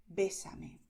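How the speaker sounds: "Bésame" with the first E held and stressed. Shown as a demonstration. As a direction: lean on the É of "Bésame". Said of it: "aquí estás destacando esta E". (acento fuerte en la E de "Bésame")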